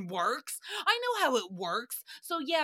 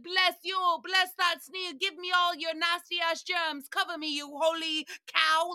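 mock worship; 6 semitones higher than usual. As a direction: gush over it like a worshipper. Mocking worshipful blessing.

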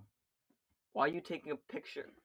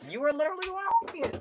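voice cracking; 7 dB louder, faster than usual. Real, not acted. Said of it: "your voice cracked".

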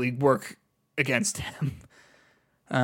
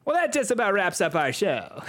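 high, projected stand-up sign-off; John Mulaney; 5 semitones higher than usual. Acted. Podcaster wraps up the show, comedian impression. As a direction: call it out like a comedian closing the set. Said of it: "I'm doing the John Mulaney voice".

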